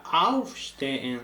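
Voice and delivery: aufstehen with the stress on auf. Shown as a demonstration. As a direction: hit auf first and let stehen fall away. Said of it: "ven que la entonación está en el auf". (acento en auf de aufstehen)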